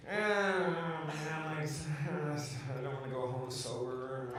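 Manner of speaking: soft, slow mumbled gloom; imitating a drunk, dejected friend. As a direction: mumble it like a sad drinker. Retold gloomy drinking friend.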